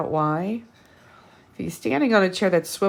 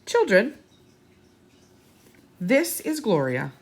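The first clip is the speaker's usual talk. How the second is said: announcing; 5 semitones higher, 3 dB louder than usual. Acted. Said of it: "announced Officer Buckle".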